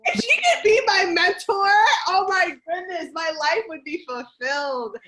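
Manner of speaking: loud, high-pitched fan gushing; excited gushing. Real, not acted. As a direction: gush about your idol, voice soaring. Gushing over her favorite actress.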